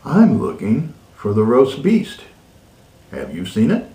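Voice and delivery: ordinary delivery, nothing marked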